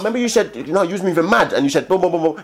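high-pitched insistent recap; acted animated comeback; mock accusing. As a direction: throw their words back at them. Acts the later confrontation.

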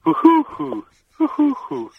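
very high, tight pigeon hoots; Hungarian pigeon coo. Shown as a demonstration. Host asks for the Hungarian pigeon, caller hoots very high. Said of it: "Now the Hungarian one".

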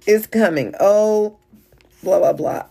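loud, breezy excuse-making; dismissive excuses. Retold or imitated. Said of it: "he would give me excuses".

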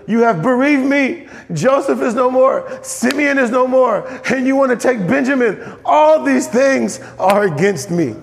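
slow, high-pitched acted grief; dramatic, drawn-out reproach from a grieving father; bereaved father's lament. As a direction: lament each loss, drawing the words out. Acts out grieving Jacob's reply.

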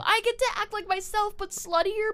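high, loud, gushing excitement; real bubbling-over excitement, voice way up high; excited yelling. Real, not acted. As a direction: squeal it, thrilled. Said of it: "I just got so excited".

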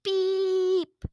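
high beep noise; buzzer beep. Acted. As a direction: beep like a game-show buzzer. Buzzer sound after slamming button.

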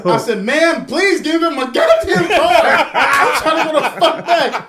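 loud, high, laughing plea; retold exasperation. Customer retells begging salon lady to hurry, loud exasperated plea. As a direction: plead in comic desperation, voice way up.

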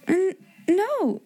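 hesitant high stammer; faltering. A narrator's reading. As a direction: falter on the N, unsure. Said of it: "Ermengarde faltered".

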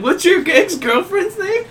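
high, slow, drawn-out fake innocence; sly teasing. Friend stirs trouble between two guys, slow high sly teasing. Friend slyly setting up a confrontation.

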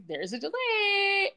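high, slow, whimpering mock cry; whiny. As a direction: whine it out like a kid about to cry. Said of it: "Brooke's like".

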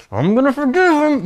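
high, strained mock determination; clenched teeth. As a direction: force it out through clenched teeth. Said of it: "with clenched teeth".

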